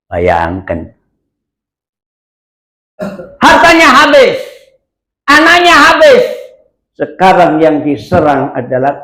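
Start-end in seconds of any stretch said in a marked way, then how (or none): 3.4-6.2 emphatic. Acted. Preacher stresses Job's losses.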